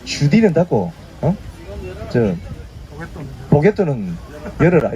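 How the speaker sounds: a light Gyeongsang dialect, saying 주디 for mouth and 보게또 for pocket; playful take in a touch of Gyeongsang dialect, 보게또 said with a hard ㄲ-like 게또. Shown as a demonstration. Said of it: "이 말을 경상도 버전, 버전으로 하면".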